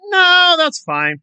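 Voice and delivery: high, drawn-out mock reassurance; sarcastic dismissal. Commentator mocking his tolerance of neo-Nazi allies, high drawn-out sarcasm. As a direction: stretch it out high, dripping with sarcasm. Sarcastic answer to own question.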